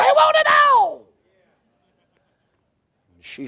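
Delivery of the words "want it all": much higher, mock-shrill punchline; comic squeal. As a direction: squeak it out for the laugh. Punchline about women wanting all.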